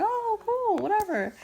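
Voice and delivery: retold high, breezy acceptance; high-pitched casual reaction. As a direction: toss it off in a high voice. Retold reaction to face reveal.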